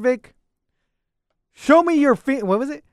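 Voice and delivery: ordinary talk, nothing marked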